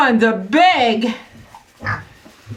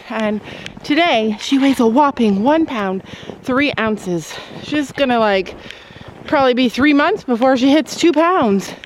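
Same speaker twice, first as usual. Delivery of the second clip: usual speaking voice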